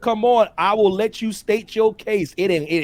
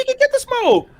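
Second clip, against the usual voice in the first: mock boast. Real, not acted. Host boasting about the guest.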